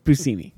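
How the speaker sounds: Buscemi said as boo-SEE-mee; corrected pronunciation. Shown as a demonstration. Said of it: "it's pronounced Buscemi".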